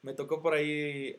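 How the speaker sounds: ordinary talk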